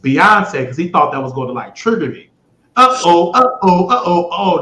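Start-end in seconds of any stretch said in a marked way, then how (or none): 2.8-4.6 loud sing-song uh-oh, teasing the agent; mock alarm. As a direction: play up fake alarm, louder. Mocking his [name] trigger.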